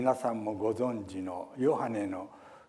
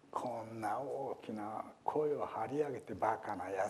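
mocking whisper; 5 dB quieter than usual. Bystanders mocking the beggar.

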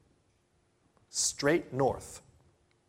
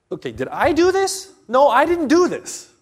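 much higher and louder, frantic; panicked self-questioning; 13 semitones higher, 12 dB louder than usual. Retold or imitated. A struggling believer questioning himself, alarmed. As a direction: ask yourself in rising alarm.

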